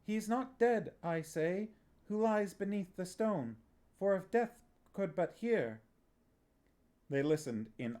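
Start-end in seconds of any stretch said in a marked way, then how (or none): 0.0-5.8 high, solemn declamation; the boy reciting the playwright's speech, high and solemn; stage recitation. A narrator's reading. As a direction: recite it like a tragic lament.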